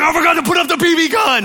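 high, loud, rushed panic; hammed-up freak-out; panicked. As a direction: blurt it out in a frantic squeal. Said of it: "full freak out panic mode".

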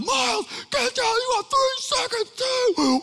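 very high mock parental shout; hammed-up countdown threat yelled up the stairs; yelling upstairs. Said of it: "as I'm yelling up the stairs".

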